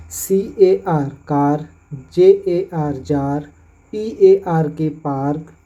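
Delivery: slow spelling, then कार, जार, park with a long 'aar'; slow spelling demo. Shown as a demonstration. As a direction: model each word slowly. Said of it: "उसका उच्चारण आर से होता है". (धीरे धीरे कार जार park)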